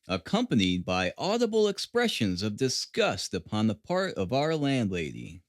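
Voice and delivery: ordinary delivery, nothing marked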